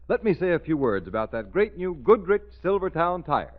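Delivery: normal talking voice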